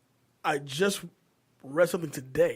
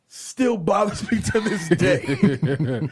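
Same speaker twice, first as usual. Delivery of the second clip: slow, laughing through the words; much slower than usual. Real, not acted. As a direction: let the laugh break the line. Laughing while recalling.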